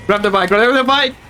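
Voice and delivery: hurried, panicky urging; urgent. Real, not acted. Urgent while fleeing horde.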